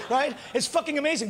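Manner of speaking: usual speaking voice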